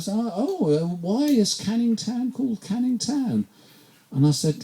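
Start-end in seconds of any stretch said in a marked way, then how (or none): 0.1-3.5 high, soft voice of a curious passenger; lightly mocked visitor's question; imitated foreign visitor. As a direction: imitate a puzzled tourist asking, pitched right up. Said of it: "and they go".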